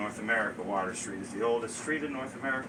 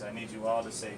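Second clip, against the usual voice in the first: ordinary talk, nothing marked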